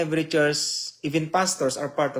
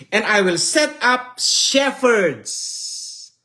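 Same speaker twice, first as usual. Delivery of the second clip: raised, slow, ringing scripture recital; solemn scripture reading; 6 semitones higher, slower than usual. Acted. Preacher reading Jeremiah to the congregation, slow and ringing. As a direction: proclaim the prophecy as God's own words.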